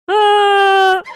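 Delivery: loud hammed-up wail; overdone mock sob; fake sob. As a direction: howl a fake cry. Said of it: "रो क्यों रहा है तुम".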